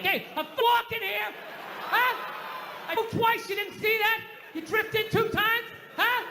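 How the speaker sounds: high, shouted New York tough guy; Dustin Hoffman. Retold or imitated. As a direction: yell it like a cabbie who got bumped on the street. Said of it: "go like old school Dustin Hoffman, De Niro".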